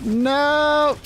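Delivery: shouted no. Real, not acted. Bomb nearly killed.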